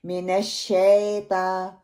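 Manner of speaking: मिनश शैता as MENASH SHETA, spread E; majhool mistake. Shown as a demonstration. Teacher showing how people err, slow SHETA. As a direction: flatten SHAY into SHE in शैता, slow. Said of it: "शीन की मजहूल अदायगी".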